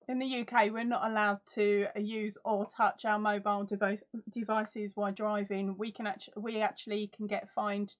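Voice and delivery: ordinary talk, nothing marked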